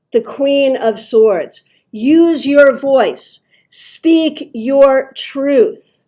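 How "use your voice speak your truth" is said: very slow, louder, each word weighted; emphatic exhortation. Real, not acted. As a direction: urge listeners on word by word. Delivers the card's rallying message.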